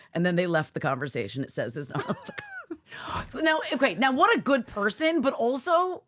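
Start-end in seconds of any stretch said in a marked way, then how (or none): 2.2-3.4 very high squealing laugh; cracking up at the stranger's reply; shrieking laugh. Real, not acted. Host laughs at the wrong number text, high squeal.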